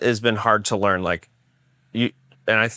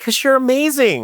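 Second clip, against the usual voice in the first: mock gushing; 4 dB louder than usual. Co-host cuts in on talk of criticism, mock gushing. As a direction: gush it in a silly high voice.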